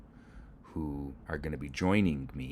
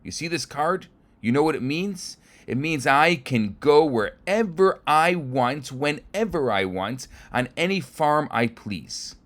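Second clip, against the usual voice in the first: much higher, louder, slow and emphatic; pompous anger; 10 semitones higher, 11 dB louder than usual. Acted. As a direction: lay down the law word by word. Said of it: "that sets the inspector off".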